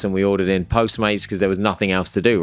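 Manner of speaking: ordinary talk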